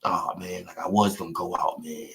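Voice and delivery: a hushed, quick mock of a defeated man; whispered mocking mumble. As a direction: whisper it like someone giving up and going to bed. Mocking Fanon's words again.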